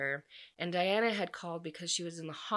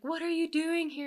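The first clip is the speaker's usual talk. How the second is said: high-pitched, delighted mock disbelief; retold happy astonishment; acted surprise; 11 semitones higher, 4 dB louder than usual. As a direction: act out a surprised reunion question, pitched way up. Acting surprised runner at finish line.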